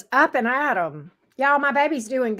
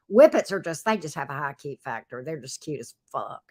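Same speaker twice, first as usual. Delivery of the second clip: ordinary talk, nothing marked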